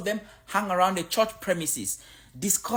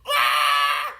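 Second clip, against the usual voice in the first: mock shout; 5 semitones lower, 7 dB louder than usual.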